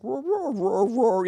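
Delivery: high yowling roar like the boxer; dog roaring. Retold or imitated. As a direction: imitate a talking dog's roar-roar. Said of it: "He's like".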